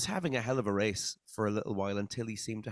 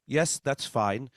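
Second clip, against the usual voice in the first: put-on louder, slow Dutch accent; Max Verstappen Dutch accent; 4 semitones higher, 7 dB louder than usual. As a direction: deliver it in a deadpan Dutch accent. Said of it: "I'll try to do my best Dutch accent".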